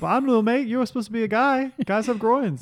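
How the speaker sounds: high-pitched mock Australian voice; Mark Wahlberg, badly Australian. Playing Wahlberg puzzled by her chat-up, mock Australian accent. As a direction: object in a high, hammy Aussie accent. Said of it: "Mark would be like".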